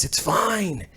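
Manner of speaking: tossed off under the breath; muttered aside. Shown as a demonstration. Brushing off the negative comments, a soft mutter. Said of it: "We like all the comments".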